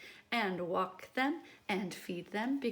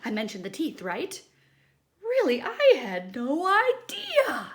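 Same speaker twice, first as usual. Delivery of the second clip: louder nervous protest; flustered backpedal; 5 semitones higher, 9 dB louder than usual. Acted. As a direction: cover your fear, flustered. Pigeon flustered.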